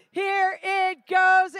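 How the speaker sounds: lively auction calling; rally the room for bids; auctioneer. Acted. Live charity auction calling.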